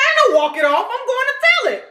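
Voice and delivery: put-on high childish refusal; high, bratty I'm-telling voice; defiant little girl. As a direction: act out a stubborn kid who won't be hushed. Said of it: "She said".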